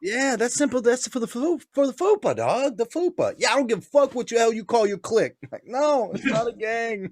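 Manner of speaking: high mock gang voice; mock gangster. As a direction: play the offended clique member. Acting gang members' quarrel.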